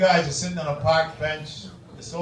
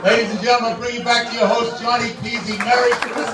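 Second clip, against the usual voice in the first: loud, higher emcee introduction; announcer; 6 semitones higher, 6 dB louder than usual. Acted. Emcee calling the host back to the crowd, booming.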